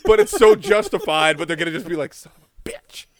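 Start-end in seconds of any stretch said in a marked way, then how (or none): 2.1-3.1 whispered, soft curse under the breath; muttered curse. Acted. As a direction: hiss it so she can't hear. Opponents cursing under breath.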